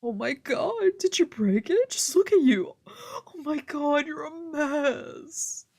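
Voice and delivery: high, near-crying dramatic wail; drama queen. Acted. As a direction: wail over him like an overdramatic wife. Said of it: "my drama queen wife".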